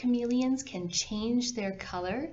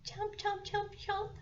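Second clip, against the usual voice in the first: high small chomps, led for the class; snapping teeth; 13 semitones higher, 6 dB quieter than usual. Shown as a demonstration. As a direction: chant along, small and high. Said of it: "Say it with me".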